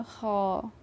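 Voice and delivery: ख said as a throaty fricative xa; ख as Urdu xa, not kha; nukta kha sound. Shown as a demonstration. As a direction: say ख with friction at the back of the throat. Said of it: "ख के नीचे नुक्ता लगा देंगे".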